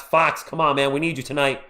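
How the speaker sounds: usual speaking voice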